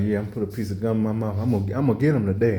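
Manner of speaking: fast. Retold or imitated. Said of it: "You like".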